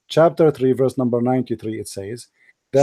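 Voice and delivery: hushed, deliberate reading aloud; low and careful, like guiding a reader; quiet careful reading. Real, not acted. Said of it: "read with me carefully".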